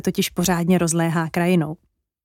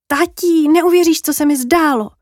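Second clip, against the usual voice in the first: put-on high, louder, eager child voice; thrilled child calling to daddy; excited little girl; 7 semitones higher, 4 dB louder than usual. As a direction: burst out with amazing news to dad. Child bursts to tell her dream.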